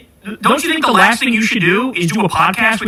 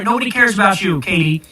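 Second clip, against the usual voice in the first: ordinary delivery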